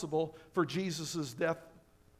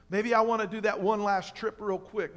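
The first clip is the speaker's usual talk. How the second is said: ordinary talk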